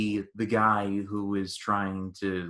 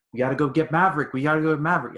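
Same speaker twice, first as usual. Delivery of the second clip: higher, louder, insistent retelling; urgent plea; 6 semitones higher, 5 dB louder, much faster than usual. Retold or imitated. Pilot pleading to go rescue his wingman, urgent. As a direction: act out an urgent rescue demand.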